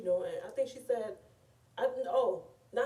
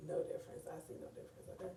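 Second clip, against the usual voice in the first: hushed deadpan aside, almost breathless; whispered aside; 3 semitones lower, 10 dB quieter, much faster than usual. Real, not acted. Telling her viewers the place is a graveyard, whispered deadpan. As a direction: drop to a whisper for the punchline.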